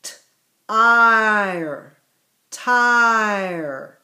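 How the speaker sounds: slow, segmenting t-ire into its sounds; slow sounding out. Shown as a demonstration. Teacher to class, slowly sounding out the word. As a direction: draw out t-ire so the class hears each part. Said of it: "teacher sounding out word".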